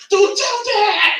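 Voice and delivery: high, loud, slow desperate cry; panicked plea. Retold or imitated. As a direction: beg like a kid who just wrecked the car. Panicked brother after car crash.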